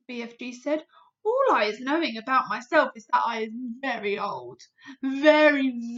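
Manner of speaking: big friendly giant voice. Acted. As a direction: play a kindly clumsy giant. BFG voice in read story.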